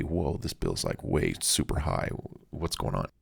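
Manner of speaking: low, quiet, unhurried surprise; soft-spoken. Retold or imitated. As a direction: murmur it calmly to yourself. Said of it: "soft-spoken".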